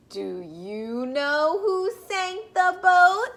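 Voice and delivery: ordinary delivery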